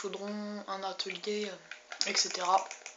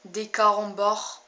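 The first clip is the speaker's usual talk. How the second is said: punchline delivery; 8 dB louder, slower than usual. Acted. Joke punchline.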